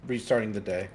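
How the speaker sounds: normal talking voice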